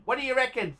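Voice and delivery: normal talking voice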